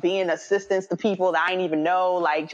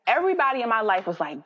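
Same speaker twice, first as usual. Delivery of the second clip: normal talking voice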